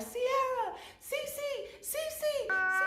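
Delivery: high, desperate calling out; screaming for sister. Retold or imitated. Stuck on the floor, calling her oldest sister for help, high desperate cries. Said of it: "So I'm screaming".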